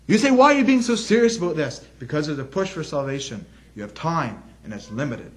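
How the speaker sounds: ordinary delivery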